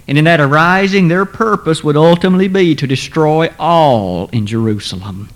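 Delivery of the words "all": ALL leaned on, a touch louder; emphasize all; stress on ALL. Shown as a demonstration. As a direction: press the word all. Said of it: "may I emphasize the word all".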